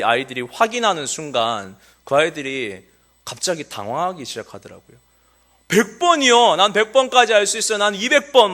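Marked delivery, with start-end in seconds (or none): 5.7-8.5 higher and louder, outbidding the others; one-up the other kids; a boy topping his classmates' answers; competing child. Retold or imitated. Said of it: "경쟁하던 아이들이".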